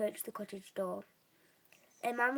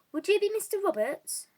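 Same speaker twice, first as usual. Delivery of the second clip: lightly voiced eager wizard; 10 semitones higher, 9 dB louder than usual. A narrator's reading. Weasley addresses the campsite owner.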